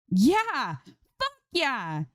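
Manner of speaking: high, jubilant cheer; triumphant scream. A narrator's reading. Streamer celebrating her first clutch kill, screaming with joy. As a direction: yell out the win, jumping up. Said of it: "Zoe screams".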